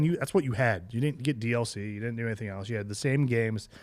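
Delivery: usual speaking voice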